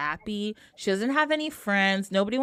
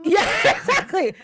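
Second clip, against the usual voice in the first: laughing yeah, pitched higher; 9 semitones higher, 3 dB louder than usual. Real, not acted. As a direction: laugh through the agreement. Laughing agreement.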